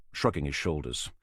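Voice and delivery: ordinary delivery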